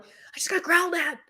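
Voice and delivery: high-pitched insistence; high, squeezed retelling; exasperated repeat. Retold or imitated.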